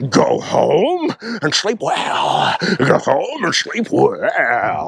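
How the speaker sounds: very slow, stretched-out line; over-the-top misquote. Retold or imitated. Imitating friend's ridiculous misquote of the movie line, very slow. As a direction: drag every word out theatrically. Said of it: "misquoting the movie as".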